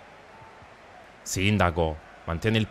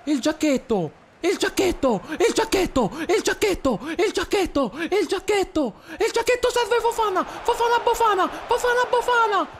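much higher, squeaky play-by-play; Mickey Mouse voice; 4 dB louder than usual. Acted. Streamer commentating his game in a squeaky Mickey Mouse voice. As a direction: call the match in a cartoon mouse squeak. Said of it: "voce alla Topolino".

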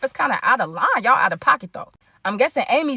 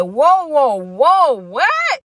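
higher, louder startled "whoa"; mock referee flagging a foul, slowing down at the end; shocked protest; 6 semitones higher, 4 dB louder, much slower than usual. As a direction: stop everything, you're thrown. Shocked by a stranger's hug.

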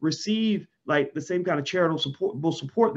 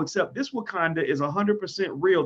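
normal talking voice

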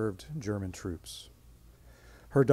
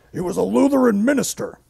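high, loud indignant retort; bristling comeback, pitched up; offended relative; 10 semitones higher, 9 dB louder than usual. Retold or imitated. Family member objecting to Nancy's question, high and indignant.

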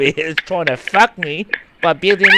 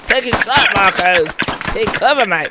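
raised, higher combat call; urgent battle call; 5 semitones higher, 3 dB louder than usual. Real, not acted. Calling Marcos to take cover, urgent battle call. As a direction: call it out over the action. Calling orders to teammate in game.

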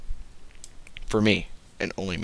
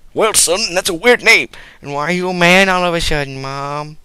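1 mock mom; 6 semitones higher, 6 dB louder than usual. Mother scolding son.

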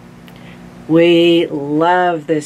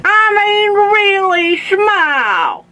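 very high, loud demand; hammed-up cross toothless old man; angry shout; 5 dB louder than usual. A narrator's reading. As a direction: draw it out in exasperation at a high pitch. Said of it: "shouted Grandpa angrily".

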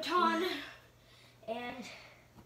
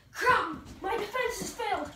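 mock panic; 5 semitones higher, 4 dB louder, slower than usual. Zombie breaks in, pretend panic.

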